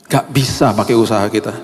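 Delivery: usual speaking voice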